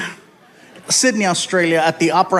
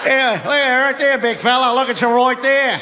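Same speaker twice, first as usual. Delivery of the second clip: higher-pitched Aussie heckler; teasing Australian accent; 5 semitones higher than usual. Retold or imitated. Australian beachgoer to big fella, teasing Australian accent. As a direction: tease him in a broad Australian accent.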